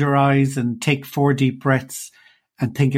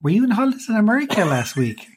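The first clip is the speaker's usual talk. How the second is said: mock inquiry, pitched up; teasing question; 6 semitones higher than usual. Parent teasing daughter about her American accent, mock-questioning. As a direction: playfully tease with a higher-pitched question.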